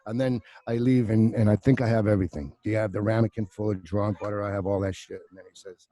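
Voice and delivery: ordinary delivery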